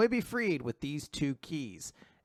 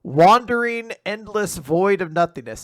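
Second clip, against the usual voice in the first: louder, higher dramatic quotation; dramatic quote; 5 semitones higher, 10 dB louder than usual. Retold or imitated. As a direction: announce it as a grand, ominous quote.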